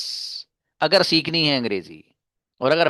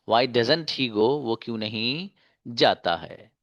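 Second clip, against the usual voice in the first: slow, deliberate teacher's model reading; measured recitation with pauses between phrases; slow drill reading; much slower than usual. Shown as a demonstration. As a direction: give each phrase room, stretching the words. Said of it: "इस अंदाज से आप conjugation पढ़ सकते हैं".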